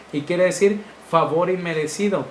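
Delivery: ordinary talk, nothing marked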